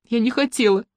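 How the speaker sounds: high, plaintive denial; tearful insistence. A narrator's reading. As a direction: keep insisting, close to tears. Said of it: "твердила Мотя".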